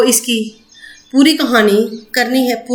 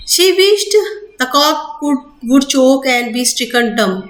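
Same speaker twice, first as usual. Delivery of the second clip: slow reread; much slower than usual. Shown as a demonstration. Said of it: "rereads the sentence".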